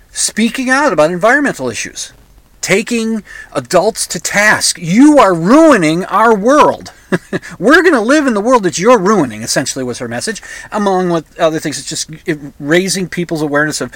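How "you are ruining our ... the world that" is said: wag a finger at the grown-ups; scolding activist. Retold or imitated. Voicing Greta scolding adults.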